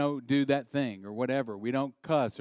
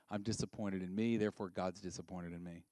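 much softer and lower, sinking into defeat; quiet dejection; 5 semitones lower, 8 dB quieter than usual. Real, not acted. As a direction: let the voice fall away as you admit it. Confessing failure, voice sinking.

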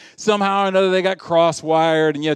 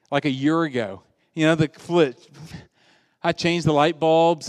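ordinary delivery

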